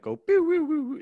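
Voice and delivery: portal sound effect. Retold or imitated. Guest imagines jumping into a portal, imitating the warp noise. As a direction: mimic a video game portal's warp whoosh. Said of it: "the noise".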